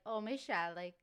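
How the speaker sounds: a light Cajun mom voice; Cajun accent. Retold or imitated. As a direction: imitate her Cajun mom, half-embarrassed. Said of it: "my mom would say".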